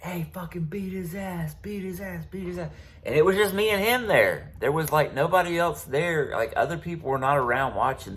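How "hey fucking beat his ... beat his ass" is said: higher, softer voices urging a fight; egging-on voices. Retold or imitated. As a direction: imitate a crowd egging him on, higher and softer. Hearing people like.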